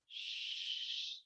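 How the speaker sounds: long drawn-out whispered curse; dismayed whisper. Retold or imitated. Count realizes he wooed his own wife, whispered dismay. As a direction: let it slip out voiceless and drawn out.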